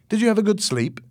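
high, cheery greeting; friendly rescuer. A narrator's reading. Coast guard to man just waking, friendly.

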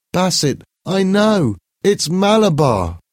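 put-on a feverish boy calls out; calling out in sleep. A narrator's reading. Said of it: "Paul called out in his sleep".

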